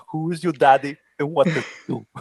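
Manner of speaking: put-on a quick Austrian action-hero accent, fast; Schwarzenegger accent. Host quoting the action movie to the co-host in Austrian accent. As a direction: rattle off the famous movie line as the Austrian tough guy.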